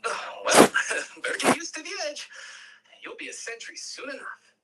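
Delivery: [name], laughing and quick, brushing it off; a hint of an amused elder, fast; breezy [name]. [name] teasing Zion about her fear of the edge, breezy.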